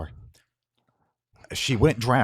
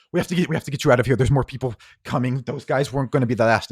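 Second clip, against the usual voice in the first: fast, higher, louder; urgent, hurried; 5 semitones higher, 7 dB louder, faster than usual. Acted. Cersei grabbing Harlan, urging him to leave. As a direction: rush it out, get him moving. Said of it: "grabs him and goes".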